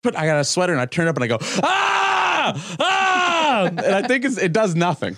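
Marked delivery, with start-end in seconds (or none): 1.6-3.7 high yelled "ah"; imitated scream. Retelling turning on two Rottweilers, high mock scream. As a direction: scream as if scaring off attacking dogs.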